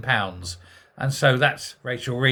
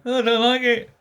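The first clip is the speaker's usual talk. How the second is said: mock teen whine, pitched up high and a bit louder; whiny school leaver; 10 semitones higher, 3 dB louder than usual. Mocking the school leaver who quits on day one, whiny high voice. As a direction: sneer as the spoiled kid quitting.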